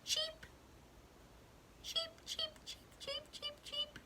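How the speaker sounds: tiny, very high soft cheeps; chicks cheeping. Acted. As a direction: peep softly like baby chicks. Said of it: "The chicks say".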